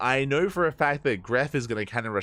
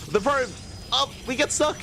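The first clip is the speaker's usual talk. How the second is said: high, loud frantic call; excited; 12 semitones higher, 4 dB louder than usual. Real, not acted. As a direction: lose it over the stuck probe. Probe gets stuck, frantic call.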